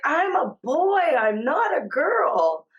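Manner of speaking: high, emphatic child imitation; retold toddler protest. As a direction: voice an upset little child insisting, drawn out. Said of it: "He was screaming and crying".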